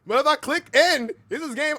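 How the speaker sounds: high, loud exasperated cry; mock wail. Streamer torn over clicking end, high loud wail. Wailing about ending the game.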